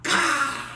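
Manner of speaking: loud, sudden voiced crash; imitated thunderclap. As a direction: burst out like a clap of thunder. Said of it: "the biggest clap of thunder".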